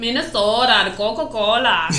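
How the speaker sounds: put-on Minnesota accent with long, round o's; exaggerated Upper Midwest Minnesota accent. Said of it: "it's like".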